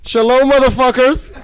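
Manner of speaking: loud, high opener to the crowd; shouted stage greeting. Real, not acted. Comic's opening greeting to crowd.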